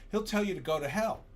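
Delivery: ordinary delivery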